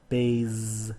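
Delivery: "Bays" held out with a buzzing Z; voiced Z ending. Shown as a demonstration. Teacher showing a voiced ending, "Bays" with long Z. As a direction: let the final S of "Bays" buzz as a Z.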